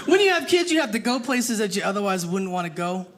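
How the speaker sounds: ordinary talk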